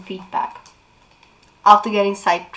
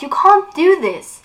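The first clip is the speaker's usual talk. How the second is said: protesting; 9 semitones higher, 6 dB louder than usual. Acted. Clary protests the torture.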